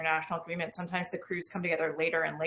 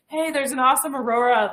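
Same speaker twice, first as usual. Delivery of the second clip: louder, higher mock call-out; retold excited shout; 6 semitones higher, 7 dB louder than usual. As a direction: call crewmates over, lightly acted. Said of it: "we were always shouting".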